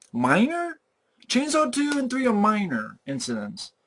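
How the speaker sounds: high, disbelieving echo of Minor?; Minor? thrown back in disbelief; scoffing at the word Minor?; incredulous. Real, not acted.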